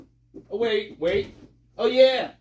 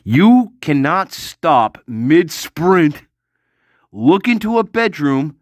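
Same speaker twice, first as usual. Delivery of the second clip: slow emphatic; 4 semitones lower, 9 dB louder, slower than usual. Real, not acted.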